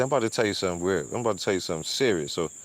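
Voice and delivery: quoting the pastor. Retold or imitated. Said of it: "He said".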